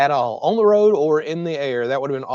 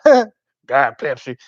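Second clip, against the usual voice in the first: drawn-out, louder imitation of a giggling server; giggly mimicry; 7 dB louder, much slower than usual. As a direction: mimic her stretching the words through a giggle. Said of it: "is how she said it".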